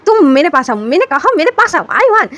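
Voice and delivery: high, loud scolding shout; angry yelling. Acted. Wing yelling at runaway Ivan, angry high shout. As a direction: chase the kid down and yell at him. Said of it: "तो ऐसे चिल्ला रहा होता है".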